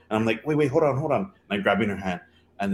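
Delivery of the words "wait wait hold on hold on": urgent interjection. Retold or imitated. Acts out stopping her mid-rush.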